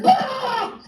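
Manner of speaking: a sudden high mocking squeal; mocking high yelp. Laughing at the group idea.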